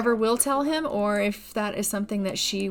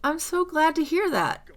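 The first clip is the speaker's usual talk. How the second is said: delighted husband; 8 semitones higher, 3 dB louder than usual. Acted. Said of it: "And he's like".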